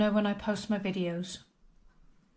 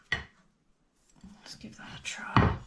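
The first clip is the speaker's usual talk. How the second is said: whisper; much slower than usual. Acted. Whispering before tasting.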